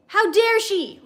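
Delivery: angry outburst. A narrator's reading. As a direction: blow up at him, angrier. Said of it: "exploded again, more angrily".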